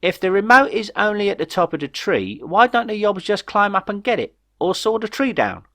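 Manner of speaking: high, pointed question; emphatic point. A narrator's reading. Jeff pointing out the obvious flaw to Ray, high and insistent. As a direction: press the logic home, voice up high. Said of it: "pointing for added emphasis".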